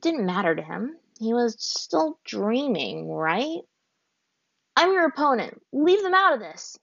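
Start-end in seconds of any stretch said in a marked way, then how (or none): 4.8-6.8 higher, louder angry warning; snarled. A narrator's reading. Aang to the waterbender, high and snarling. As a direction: bite off each word at the enemy. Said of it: "he snarled".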